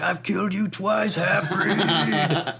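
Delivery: high, rough orc growl. Acted. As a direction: snarl it like a monster orc. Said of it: "So that's my orc voice".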